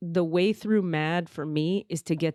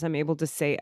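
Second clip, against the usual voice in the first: usual speaking voice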